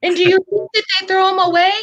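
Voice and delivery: high-pitched incredulous rant; going off. Retold or imitated. Retelling a man's TikTok rant, going off. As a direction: retell it as an outraged, animated tirade. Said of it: "he's, like, going off".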